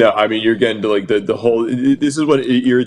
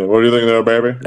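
[name] impression; 3 dB louder, faster than usual. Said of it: "doing the [name] accent".

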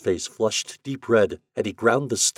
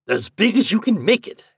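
high eager rush; 14 semitones higher, 8 dB louder than usual. Acted. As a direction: grab the chance eagerly. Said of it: "he said eagerly".

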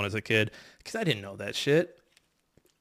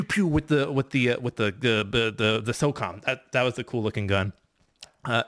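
ordinary talk

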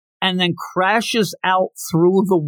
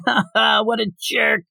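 sneering bully; 7 semitones higher than usual. Retold or imitated. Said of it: "and they're like".

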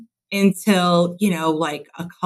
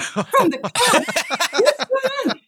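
high, slow, incredulous cry with a laugh; stunned mother catching a laugh; shocked disbelief; slower than usual. Retold or imitated. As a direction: ask it as if you can't believe it.

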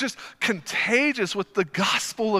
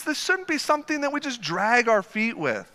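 ordinary delivery

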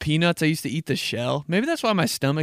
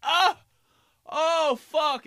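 high yelp then curse; high surprised ouch; pained yelp; much slower than usual. Retold or imitated. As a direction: yelp as the cup pops off. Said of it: "I'd be like".

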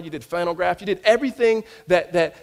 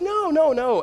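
friend's high no; 10 semitones higher, slower than usual. Retold or imitated. Friend's reply voiced.

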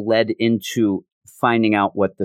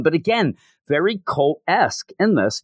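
ordinary talk, nothing marked

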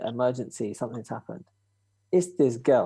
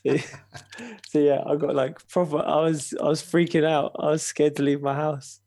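ordinary delivery

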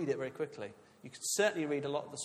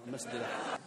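ordinary talk, nothing marked

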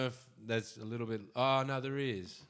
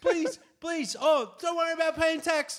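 high, loud mock pleading; desperate eager Australia; 12 semitones higher, 6 dB louder than usual. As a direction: beg hard, hammed-up and frantic. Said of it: "Australia be like".

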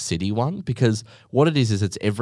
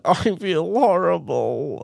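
slow, drawn-out mock groan of regret; 8 semitones higher, much slower than usual. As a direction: moan it like a sorry glutton. Regretting KFC again.

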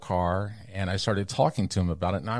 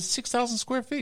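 much higher, disbelieving; incredulous protest; 3 dB quieter than usual. Retold or imitated. Owner arguing with the architect, high incredulous protest. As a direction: argue it in disbelief. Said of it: "I said".

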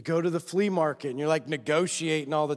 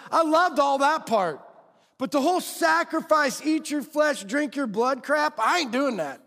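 complaining fair-weather follower; 6 semitones higher than usual. Retold or imitated. Follower tells Jesus he liked the miracles but not the sacrifice, griping. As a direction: whine that the sacrifice part is too much.

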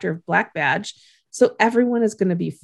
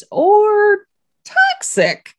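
drawn-out toxic; 14 semitones higher, 6 dB louder, much slower than usual. Real, not acted. Cohost recapping the couple, slow high teasing drawl. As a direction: stretch "toxic" slowly, high and loud.

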